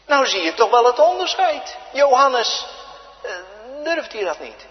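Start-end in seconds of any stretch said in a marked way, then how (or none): 0.1-1.8 loud, fast pulpit shout; a sudden raised preaching cry; emphatic exhortation. Acted. 1.9-2.6 loud, high, urgent preaching to the church; a rousing pulpit call; full-force preaching. Acted. Preacher calls out to congregation.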